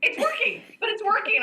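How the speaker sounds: thrilled that it works; hammed-up excited mom. Mom excited about gate.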